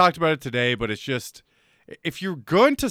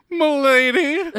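mock gallant; 7 semitones higher, slower than usual.

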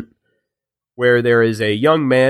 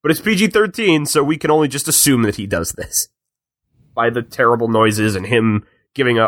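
ordinary talk, nothing marked

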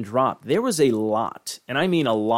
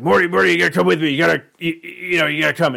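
put-on fast, high, loud drunk ramble; slurring drunk old scientist; drunk Rick voice; 5 semitones higher, 5 dB louder, faster than usual. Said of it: "He's drunk as fuck".

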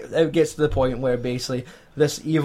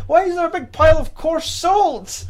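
much higher, hammed-up whining question; mock despair; 14 semitones higher, 3 dB louder than usual. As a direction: whine at fate, comic outrage. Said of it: "It's like".

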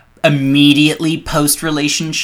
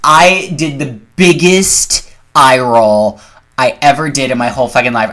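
normal talking voice

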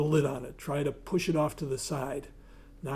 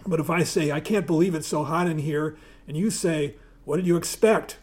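louder, lively reading from a book; reading aloud; 4 dB louder than usual. Acted. Reading Tina Fey's improv rules to the audience, lively read-aloud voice. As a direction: read it out to the room with energy. Reads second rule aloud.

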